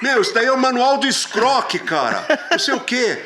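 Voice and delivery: high, loud indignant complaint; indignant singer. Retold or imitated. Teller imitates the singer scolding him, high and loud. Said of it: "ele fala assim".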